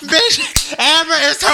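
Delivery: high-pitched laughing words. Real, not acted. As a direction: talk through real laughter. Cracking up at friend.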